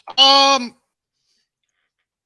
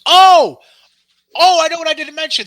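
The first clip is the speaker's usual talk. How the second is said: loud, high burst of excitement; excited exclamation, then a quick rush; sudden remembering; 7 semitones higher, 11 dB louder than usual. Real, not acted. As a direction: blurt it out as it comes back to you. Suddenly remembers a news item.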